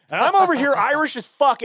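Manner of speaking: ordinary talk, nothing marked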